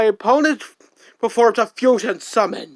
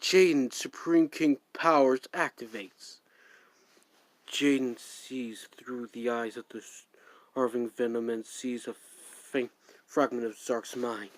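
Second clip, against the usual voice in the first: low, much softer whisper; whispered narration; 13 semitones lower, 13 dB quieter than usual. Acted. Narrator describes Jaden's glimpse into Zark's mind, whispered. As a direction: breathe it out like a secret inner voice.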